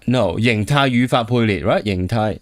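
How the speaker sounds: ordinary delivery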